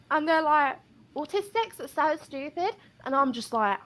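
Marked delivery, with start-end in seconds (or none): 1.2-2.7 higher, softer sneering customer; a lightly voiced snide customer; mock customer voice.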